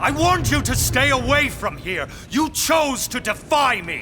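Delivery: angry shout. Acted. Lord scolding Agatha for defying him, shouting angrily. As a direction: shout her down for trespassing.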